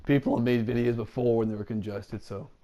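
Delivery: ordinary delivery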